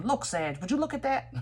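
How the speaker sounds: lightly voiced Most High, rising high and playful; a hint of a god teasing from above; teasing divine voice. God holding Sag upside down, mock teasing voice. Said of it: "the Most High would kinda grab you ... saying".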